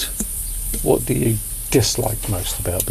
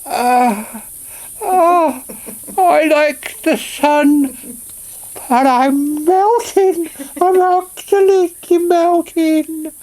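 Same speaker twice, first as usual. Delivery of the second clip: high, slow, drawn-out wilting groan; a flower melting in the heat; melting sunflower; 5 dB louder, much slower than usual. Shown as a demonstration. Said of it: "That's my impression of a sunflower".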